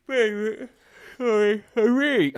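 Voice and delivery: words stretched through a yawn. Real, not acted. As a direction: talk through a big yawn. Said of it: "I managed not to yawn".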